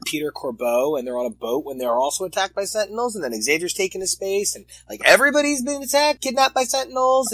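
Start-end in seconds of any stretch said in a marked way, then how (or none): 5.0-7.3 high-pitched, rushed mock panic; mock alarm. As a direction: blurt out the disaster much higher and louder. Recounting the mayhem in mock alarm.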